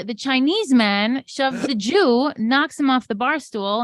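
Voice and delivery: ordinary talk, nothing marked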